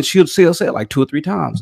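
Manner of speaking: normal talking voice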